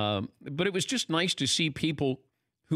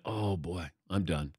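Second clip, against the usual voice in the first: quiet resigned; 7 semitones lower, 5 dB quieter, slower than usual. Retold or imitated. Realizing he'll cry on stage.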